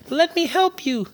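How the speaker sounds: high squeaky mouse voice; squeaking mouse. Acted. The mouse offering to free the trapped lion, squeaky. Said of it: "squeaked the little mouse".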